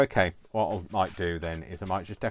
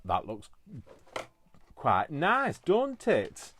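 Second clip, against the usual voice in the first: Yorkshire slip; 7 semitones higher, 6 dB louder, much slower than usual. Real, not acted. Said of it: "suddenly become right, right Yorkshire".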